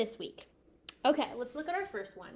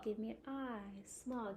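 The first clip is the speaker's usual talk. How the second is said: quiet echo; 11 dB quieter than usual. Shown as a demonstration. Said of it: "I'm gonna say it, you repeat".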